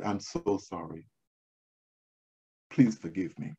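low, hushed, contrite plea; retold apology. Visitor asking the hospital greeter's forgiveness, softly contrite. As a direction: apologise softly, voice dropped right down.

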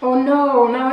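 talking while chewing candy; words around a mouthful; mouth full, chewing. Real, not acted. Seen 1: Dark-haired speaker bit a candy stick; mouth working while speaking.

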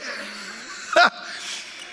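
high laugh tailing off; real laugh. Real, not acted. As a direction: let the laughter run out. Laughs at own joke.

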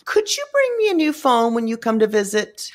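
high-pitched mimicry of a boyfriend asking a favor; boyfriend's wheedling request. Boyfriend asking her for a new phone, high mimicking voice. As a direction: put on his high pleading voice. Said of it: "And he's like".

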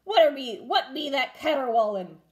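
loud annoyed pirate; pirate holler. A narrator's reading. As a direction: shout over the crying baby. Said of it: "hollered Braidbeard".

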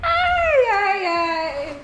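high squeal of laughter, then sighs. Real, not acted. Laughing at her own joke. (gargalhada aguda)

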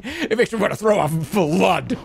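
overdramatic complaint, stress on makes; drama queen. Real, not acted. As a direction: ham up the suffering, punching makes. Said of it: "What a drama queen".

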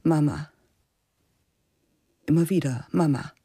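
ordinary delivery

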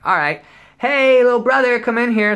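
high, loud call to the next room. Retold or imitated. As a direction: shout for little brother sweetly. Calling brother to the door.

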